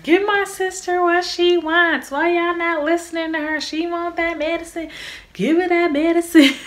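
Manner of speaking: high-pitched, insistent mock demand; imitated demanding sister. Little sister demanding pain medicine for laboring sister, high and insistent. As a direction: plead her case in a high voice.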